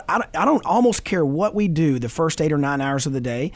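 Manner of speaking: ordinary delivery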